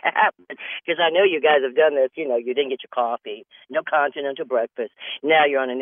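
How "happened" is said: mock yell through laughter; laughing holler. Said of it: "I'm screaming and hollering".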